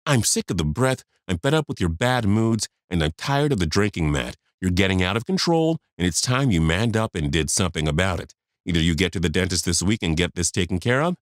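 ordinary delivery

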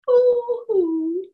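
high mock-disappointed ooh; a put-on little cry of dismay; mock sad ooh. Sighing to the kids that the frogs are gone, a mock sad ooh.

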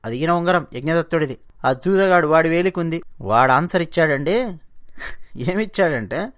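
ordinary talk, nothing marked

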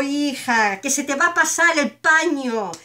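ordinary talk, nothing marked

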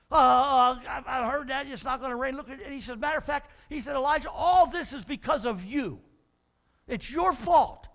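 mild angry accusation; blaming. Acted. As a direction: point the finger hard on your fault. Ahab blaming Elijah angrily.

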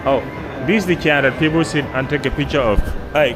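ordinary delivery

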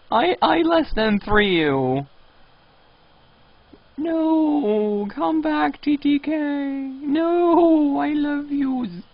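high, slow clingy whine; pretend-heartbroken baby voice; mock pleading. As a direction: beg him sweetly to stay. Pleading with leaving player.

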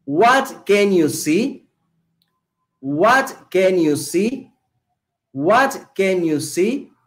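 "What can you see?" at half speed, rising at the end; slow drill repetition. Shown as a demonstration. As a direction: drill the question slowly for students. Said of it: "Empezamos el ejercicio".

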